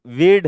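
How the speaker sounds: "वीड" punched loud with a long ee; correct pronunciation. Shown as a demonstration. Teacher insisting on the right reading, emphatic long-ee "वीड". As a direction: stress "वीड" as veed, no r. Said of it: "इसको कोई कहेगा वियर्ड".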